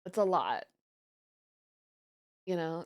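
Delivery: ordinary talk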